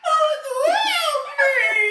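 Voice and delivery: loud, very high wail, cracking into laughter; hammed-up plea for help, laughing through it; mock cry for help. Nephew on the stairs cries.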